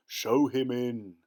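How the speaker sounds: slow, loud ogre bellow; ogre roar. Acted. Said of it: "roared the ogre".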